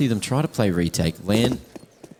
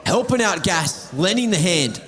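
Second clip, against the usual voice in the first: excited; 9 semitones higher than usual. Real, not acted. Casting a chaotic fight live.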